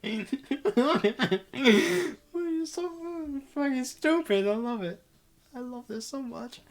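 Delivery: high, laughter-squeezed voice; real helpless amusement spilling into the words; can't stop laughing. Real, not acted. Reader finding the scene stupid and loving it, laughing. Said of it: "I just can't stop laughing".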